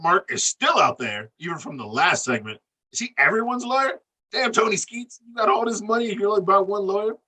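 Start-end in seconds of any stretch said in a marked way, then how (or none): 2.9-7.1 high, fast mocking questions; mocking disbelief. Real, not acted. Host mocks Tony Skeets about his lawyer, high and fast. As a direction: ridicule the man, pitched up.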